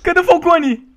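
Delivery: loud, high searching call; excited yell; excited high shout. Real, not acted. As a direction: hunt for him, still fired up. Excited after big play.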